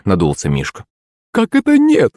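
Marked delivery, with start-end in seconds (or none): 1.3-2.1 high-pitched indignant cry of disbelief; shocked mother's outcry; startled exclamation. A narrator's reading. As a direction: exclaim it, voice leaping up. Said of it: "воскликнула маман".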